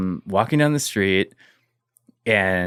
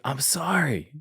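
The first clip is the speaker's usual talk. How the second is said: mild crying; 5 semitones higher, 6 dB quieter, slower than usual. Retold or imitated. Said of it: "And he just started crying".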